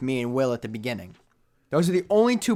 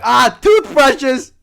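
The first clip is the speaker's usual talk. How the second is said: drawn-out, much higher and louder mock chuckle; mock amused crowd; 10 semitones higher, 9 dB louder, much slower than usual. Everyone teases him about toothbrushes, slow mock amusement. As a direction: stretch it like a teasing crowd. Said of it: "everyone's gonna be like".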